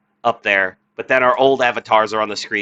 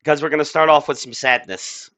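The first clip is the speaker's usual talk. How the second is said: ordinary talk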